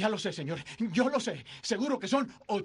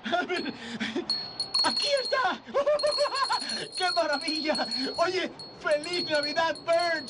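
ecstatic; 5 semitones higher than usual. Acted. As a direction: shriek with delighted laughter. George finds the little petal.

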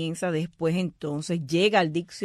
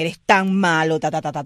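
louder exclamation, Eres tan malo; mock scolding exclamation; exclamative intonation; 7 dB louder than usual. Shown as a demonstration. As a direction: exclaim it with lots of emphasis. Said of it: "en la entonación exclamativa".

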